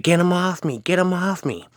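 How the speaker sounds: high-pitched imitation of a panicked man; mock screaming. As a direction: act out someone freaking out. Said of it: "he was, like, screaming".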